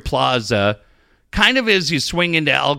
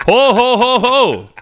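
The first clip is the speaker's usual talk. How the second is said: jolly salesman laugh; 8 semitones higher, 5 dB louder, slower than usual. Retold or imitated. Playing salesman greeting customer.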